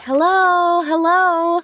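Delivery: high and loud hello across the yard; calling out. Retold or imitated. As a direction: call out to whoever might be in the barn. Calling out at empty farmhouse.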